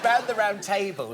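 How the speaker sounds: normal talking voice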